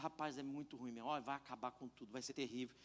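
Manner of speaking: gloomy complaint. Retold or imitated. Pastor voicing his gloomy complaint.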